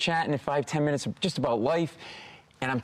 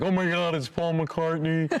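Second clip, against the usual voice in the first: starstruck; 6 semitones higher, slower than usual. Retold or imitated. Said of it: "I'm like".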